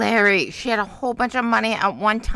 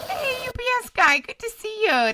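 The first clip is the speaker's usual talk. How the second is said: much higher, sing-song hello; cheery high greeting. Real, not acted. Streamer greets a viewer who just joined, high and cheery. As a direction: greet the newcomer brightly.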